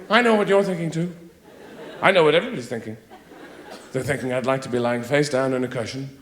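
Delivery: ordinary delivery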